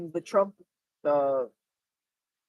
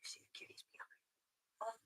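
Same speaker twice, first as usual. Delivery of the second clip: soft unvoiced whisper; 30 dB quieter, slower than usual. Real, not acted. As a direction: whisper so the cat stays. Whispered aside.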